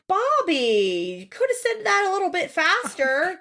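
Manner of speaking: high-pitched mock scolding; exasperated retort. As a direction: scold her in rising exasperation. Acted exasperated retort to Bobby.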